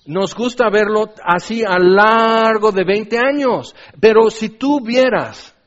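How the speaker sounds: urgent, driving sermon delivery; forceful preaching. Real, not acted. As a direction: pound out each consequence emphatically.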